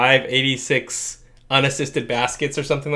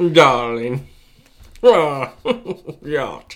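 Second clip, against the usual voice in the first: rich yacht guy drawl; old-timey rich man accent; mid-Atlantic accent; much slower than usual. Pretend. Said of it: "mid-Atlantic accent".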